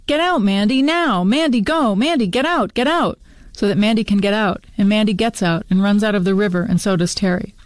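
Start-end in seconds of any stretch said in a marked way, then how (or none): 0.1-3.2 high, repeated swooping cries at speaking volume; restrained narrated scream, each command pitched up; urgent mock scream. A narrator's reading. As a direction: urge a friend out with high, insistent calls. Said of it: "and screams at Mandy".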